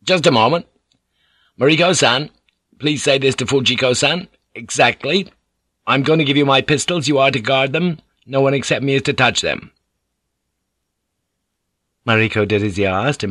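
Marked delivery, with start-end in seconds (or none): 0.0-9.7 higher, firm character voice; Blackthorn, commanding. Acted. Blackthorn hands his pistols over through Mariko, commanding. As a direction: give orders in a raised, firm voice.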